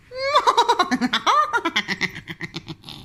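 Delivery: high cackling witch laugh; evil witch cackle. Shown as a demonstration. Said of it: "do you want to hear my evil, evil witch laugh".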